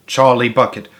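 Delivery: ordinary delivery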